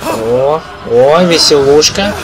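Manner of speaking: loud, slow mock delight; sarcastic cheer. Real, not acted. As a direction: drawl it out ironically. Sarcastic reaction to scare.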